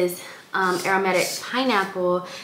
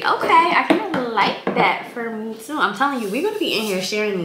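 excited; 5 semitones higher, 5 dB louder than usual. Real, not acted. Pleasantly surprised by the scent.

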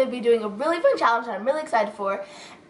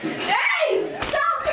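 much higher squealed protest; excited protest. Real, not acted. Playful protest.

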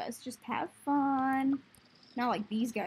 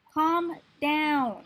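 exasperated order; 4 semitones higher, 7 dB louder than usual. Real, not acted. Scolding out-of-control slimes.

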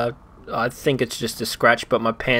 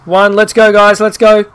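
loud urgent countdown, pitched up; shouted countdown; 7 semitones higher, 9 dB louder than usual. Real, not acted. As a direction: call it out loud to hurry everyone. Countdown, rushing people off bus.

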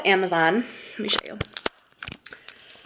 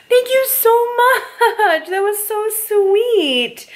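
overjoyed thanks; 11 semitones higher, much slower than usual. Real, not acted. Reacting to the letter.

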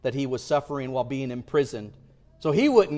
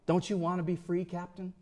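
soft, hushed plea with a light southern lilt; a quiet southern-drawled coaxing; hushed southern soldier; 10 dB quieter than usual. Retold or imitated. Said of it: "came to him silently and said".

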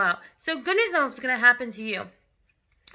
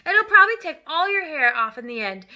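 loud, raised exasperated scolding; scolding wife; 5 semitones higher, 7 dB louder than usual. Acted. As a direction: lecture a stupid husband. Mother berates husband over dye.

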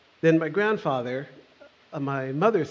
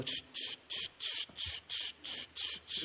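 soft rhythmic voiceless maraca hiss; imitated maraca shaking; 15 dB quieter, slower than usual. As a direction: keep up a quiet, steady "ch, ch" like a shaker.

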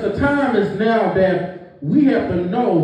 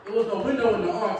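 ordinary talk

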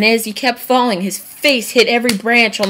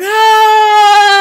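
high, loud falling yell; falling scream; 13 semitones higher, 10 dB louder than usual. Retold or imitated. Star falling from the tree, high yell. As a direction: scream like you're tumbling.

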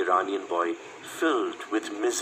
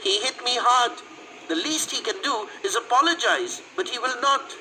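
put-on high, complaining girl's voice; aggrieved girlish outburst; girl crying out; 13 semitones higher than usual. A narrator's reading. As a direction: protest indignantly in a high girl's voice. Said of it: "cried the girl".

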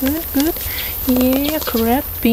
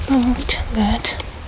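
whispering; slower than usual. Acted. Whispered praise.

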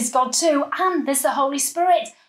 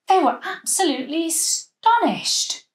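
usual speaking voice

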